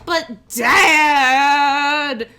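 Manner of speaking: put-on loud, high teen whine of protest; a teenager whining at his father, loud. Eldest son objecting to his dad, loud whine. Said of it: "he goes".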